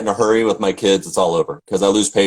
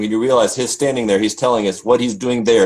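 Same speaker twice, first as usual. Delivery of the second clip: ordinary talk, nothing marked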